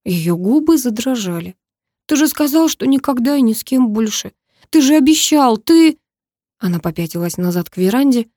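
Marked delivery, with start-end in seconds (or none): 2.1-6.0 high, upset reproach growing louder; hurt accusation. A narrator's reading. Bride to the groom who promised, high hurt accusation. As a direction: accuse him of a broken promise.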